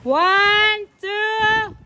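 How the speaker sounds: shouted count of one, two; loud countdown. Real, not acted. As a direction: call the count out high and loud. Counting down a jump.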